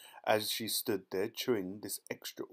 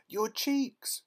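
very high, loud shriek; panicked mother's high cry; horrified scream; 12 semitones higher, 4 dB louder than usual. A narrator's reading. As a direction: scream it in alarm. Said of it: "screamed Missus Beauregard".

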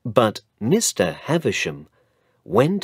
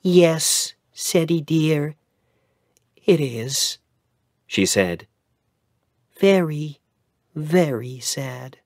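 a hint of a tender mother, slowing at the end; lightly voiced mother; 7 semitones higher than usual. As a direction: soothe your son gently.

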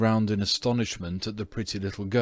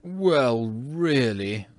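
drawn-out, mildly abashed protest; wounded but yielding ghost; rather meek; 3 semitones higher, 4 dB louder, much slower than usual. A narrator's reading. Ghost caught stealing paints, rather meek. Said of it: "said the ghost rather meekly".

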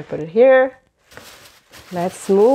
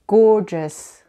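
ordinary delivery

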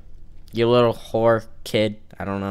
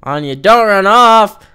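loud, higher call after someone running off; loud call across the map; 10 semitones higher, 7 dB louder, slower than usual. Real, not acted. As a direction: shout after her to come back. Calling after running character.

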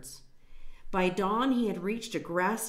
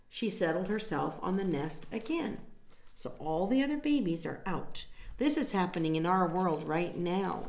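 ordinary delivery, nothing marked

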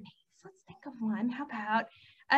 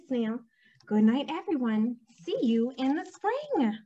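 lively, sing-song storybook delivery; animated picture-book narration; story reading to children; 4 semitones higher, 5 dB louder than usual. A narrator's reading. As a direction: read it to little ones with big pitch swings.

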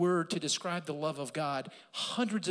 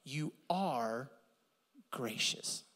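whispered; 5 semitones lower, 6 dB quieter, much slower than usual. Shown as a demonstration. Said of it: "here's the four things".

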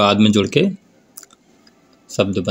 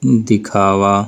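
slow demo word; much slower than usual. Shown as a demonstration. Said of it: "तो देखिए".

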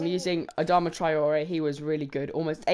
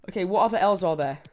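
ordinary delivery

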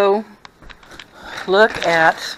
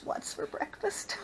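whispered tease; 11 semitones higher, 17 dB quieter, slower than usual. Acted.

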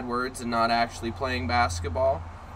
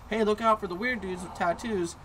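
high-pitched cautioning parent voice; mock parental warning; imitated warning parent; 9 semitones higher than usual. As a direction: warn the kids like a worried parent. Voices parents warning their children.

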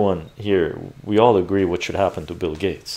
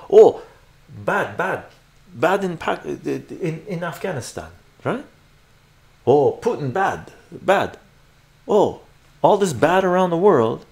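high, exaggerated simpleton alarm; mock alarm; 7 semitones higher, 5 dB louder than usual. Streamer mocking people told who is bad worldwide, exaggerated mock alarm. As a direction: burst out with dim-witted, put-on outrage.

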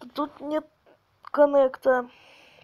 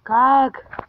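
ordinary talk

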